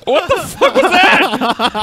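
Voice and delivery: stunned outrage. Retold or imitated. [name] after Gatorade dumped on his head, slow high outrage. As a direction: react as if soaked out of nowhere. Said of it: "I was like".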